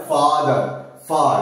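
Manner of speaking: ফাদার and ফার with a fully open, long আ vowel. Shown as a demonstration. Teacher shows the long open আ in ফাদার. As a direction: stretch the আ in ফার wide open. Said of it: "পুরোপুরি open যে আটা হয়".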